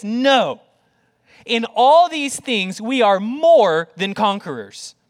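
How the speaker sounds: loud, slow, ringing pronouncement; emphatic declaration. Acted. Preacher voices Paul's answer to doubters, emphatic. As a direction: proclaim it firmly, weighting each word. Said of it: "Except Paul says".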